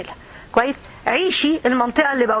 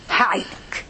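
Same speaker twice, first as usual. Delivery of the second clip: hushed promise; 2 semitones lower, 4 dB quieter, slower than usual. Acted. God promises help to the worshipper, hushed. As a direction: promise help almost in a whisper.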